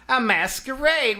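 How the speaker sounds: light up at the happy news; delighted. Acted. Mr Manchester relieved that Lily isn't eloping, delighted.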